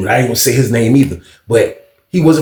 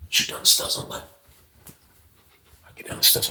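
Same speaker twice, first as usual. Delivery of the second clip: hushed; 13 dB quieter than usual. Retold or imitated. Sneaking down to the meeting.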